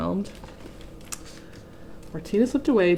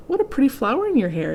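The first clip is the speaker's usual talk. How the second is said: lightly voiced duck; 6 semitones higher, faster than usual. Duck character speaks.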